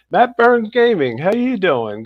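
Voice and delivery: energetic live-show host welcome; livestream shout-outs. Acted. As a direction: hype up each viewer as you greet them. Host greeting viewer.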